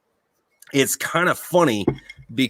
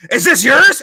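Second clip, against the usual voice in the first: high loud demanding question; drill instructor yell; 13 semitones higher, 9 dB louder than usual. Retold or imitated. Drill instructor questioning each recruit, yelling. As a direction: shout it at the recruit.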